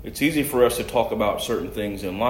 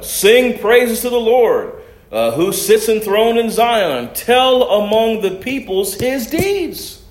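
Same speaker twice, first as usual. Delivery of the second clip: raised, ringing pulpit reading of scripture; 10 semitones higher, 6 dB louder, slower than usual. Acted. Preacher reading John chapter seven aloud to church, proclaiming. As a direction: proclaim the passage to the congregation.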